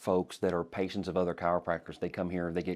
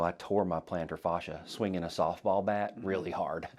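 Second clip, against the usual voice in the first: ordinary talk, nothing marked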